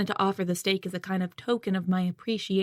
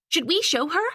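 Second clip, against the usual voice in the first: high, eager and louder; bursting to share a secret; excited; 8 semitones higher, 4 dB louder than usual. A narrator's reading. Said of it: "Henry asks excitedly".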